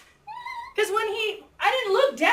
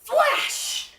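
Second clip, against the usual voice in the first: high, sudden storyteller burst; dramatic exclamation; 10 semitones higher than usual. Retold or imitated. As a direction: burst out like a lightning strike. Imitating lightning in the story.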